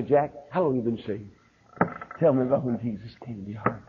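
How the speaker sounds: gentle asking. Retold or imitated. Preacher asking a pastor friend about salvation, softly. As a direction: ask kindly and quietly. The kind way to ask.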